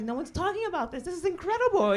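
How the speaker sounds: high, loud retold amazement; amazed outburst. Comic recalling a first discovery to the crowd, high amazed outburst. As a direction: exclaim it like an astonishing discovery.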